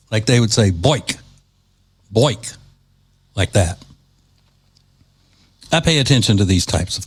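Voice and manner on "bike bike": upstate New York accent. Shown as a demonstration. Host demonstrating the upstate New York way of saying bike. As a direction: give "Boik" the upstate New York oi vowel. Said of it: "an O I sound to it".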